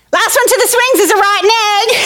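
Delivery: put-on high-pitched kid's race challenge; excited child voice, acted; playground dare. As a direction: call it out gleefully to your friends.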